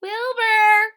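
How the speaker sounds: high, loud calling shout. Acted. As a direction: yell his name through the door. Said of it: "shouted Francine".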